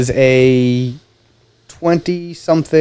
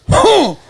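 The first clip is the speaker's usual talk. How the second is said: short, high-pitched "huh" mimicking the roommate; mock dismissive huh; imitated huffy grunt. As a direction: huff it out high and abrupt, in imitation. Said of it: "And she was like".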